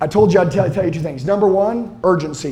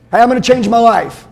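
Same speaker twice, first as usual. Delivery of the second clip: normal talking voice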